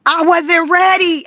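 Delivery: loud, slow, high cry of shock; emphatic shout. Real, not acted. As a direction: belt it out like you've been ambushed. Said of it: "When I say I was not ready".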